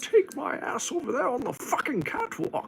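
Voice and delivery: high strained villain voice; Bane voice. Acted. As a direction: sing out the catwalk like Bane. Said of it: "Stop turning into Bane when you do it".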